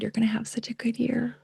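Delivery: soft unvoiced whisper. Contestant whispering good wishes to Miss Texas onstage. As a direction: lean in and whisper kindly. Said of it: "And I just looked at Melissa and I said".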